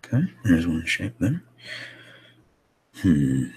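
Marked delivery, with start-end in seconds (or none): none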